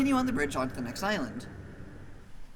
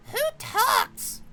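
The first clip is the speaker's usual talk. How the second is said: much higher, louder squeaky threat; squeaky tiny maggot; 4 dB louder than usual. Acted. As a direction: squeak a tough-guy warning.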